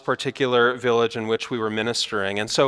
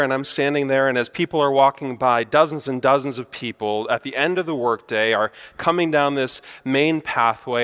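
usual speaking voice

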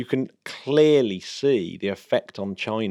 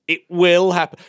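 higher and louder, stress on will; louder, higher firm promise; emphatic repeat; 8 semitones higher, 7 dB louder than usual. Real, not acted. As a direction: insist it, punching will.

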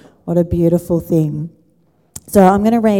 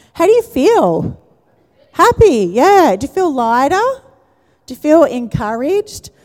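high, loud, eager question; calling out to congregation; 7 semitones higher, 6 dB louder than usual. Real, not acted. Pastor urging the congregation to say happy, loud and high. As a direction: coax the room to answer.

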